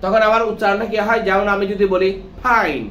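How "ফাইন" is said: "ফাইন" begun with a puffed p-h, no teeth on lip; mispronounced F. Shown as a demonstration. Teacher showing learners a wrong "ফাইন", mispronounced with puffed p-h. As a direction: say "ফাইন" as phain, an aspirated p. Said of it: "যদি ফ উচ্চারণ করো".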